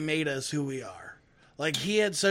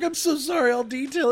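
high, louder mock grovelling; high apologetic voice; 8 semitones higher, 6 dB louder than usual. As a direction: grovel it, pitched way up.

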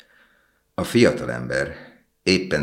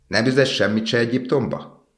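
higher-pitched eager cry; raised, lilting exclamation; calling out; 8 semitones higher than usual. A narrator's reading. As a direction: call the question out, pitched up. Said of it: "kiáltotta".

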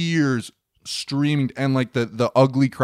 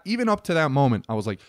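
ordinary delivery

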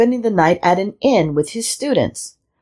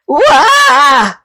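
loud, high yell of alarm; startled shout; 8 semitones higher, 5 dB louder than usual. A narrator's reading. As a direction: scream "Wah!" and bolt. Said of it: "the weaver shouted".

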